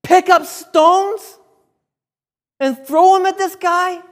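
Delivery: acted furious rebuke, high and loud; shouted challenge in separate, drawn-out bursts; anger. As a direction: thunder at the false representatives in outrage. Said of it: "the anger is coming out".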